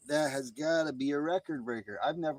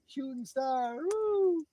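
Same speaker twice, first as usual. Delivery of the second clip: high-pitched sing-out, rising to a whoop; playful whoop; 12 semitones higher, slower than usual. Pretend. Cheering a shooting star, high playful whoop. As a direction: call it out high and drawn.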